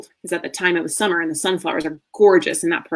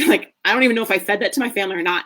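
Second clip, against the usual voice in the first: ordinary talk, nothing marked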